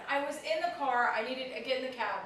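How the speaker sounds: ordinary delivery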